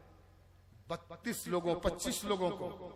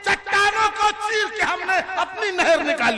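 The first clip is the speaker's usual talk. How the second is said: high, loud mushaira recital; declaimed poetry; 11 semitones higher, 12 dB louder than usual. Acted. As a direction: ring it out to the crowd. Recites verse to audience. (बुलंद आवाज़ में शायरी)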